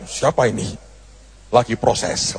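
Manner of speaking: low, soft puzzled mutter; a hint of a confused Putin; surprised Putin. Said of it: "Presiden Putin akan kaget".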